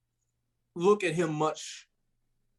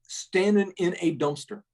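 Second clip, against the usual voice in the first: normal talking voice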